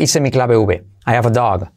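ordinary talk, nothing marked

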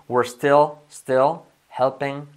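"still" with a clear L, said slowly; slow, lean on the L in "still"; slow drill. Shown as a demonstration. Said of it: "Raquel, still". ("still" lento, marcando la L)

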